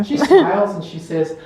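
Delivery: ordinary talk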